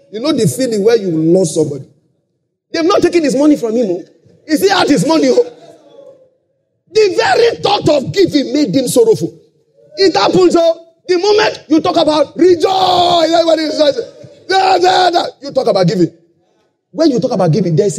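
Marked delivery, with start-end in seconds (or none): 2.7-13.9 preaching at full force. Acted. 14.5-15.2 high, loud excited chant; mock rejoicing. Preacher mimicking congregation at rejoicing talk, excited shouts.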